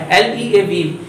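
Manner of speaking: ordinary talk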